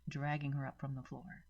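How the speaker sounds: usual speaking voice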